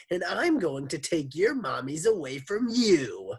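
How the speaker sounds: high, loud threatening voice; menacing villain. A narrator's reading. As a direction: sneer the threat. Villain voice in story.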